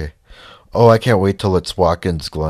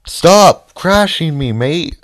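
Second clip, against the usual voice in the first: higher, slow mock Australian yell; mock Australian accent; 10 semitones higher, 9 dB louder, slower than usual. Gamer mocking a rival driver in mock Australian. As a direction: put on a hammed-up Australian accent. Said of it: "Because I'm Australian?".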